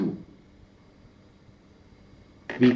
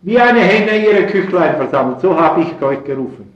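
loud, high impassioned preacher voice; fervent preaching; 6 semitones higher, 7 dB louder than usual. Acted. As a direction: preach it at full force from the pulpit. Preacher quoting Jesus.

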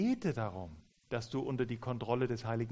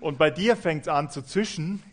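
loud, heated, a little higher; raised voice; 4 semitones higher, 8 dB louder than usual. Shown as a demonstration. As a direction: keep the volume up as tempers boil. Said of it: "deine Stimme hebst". (laut und aufgebracht)

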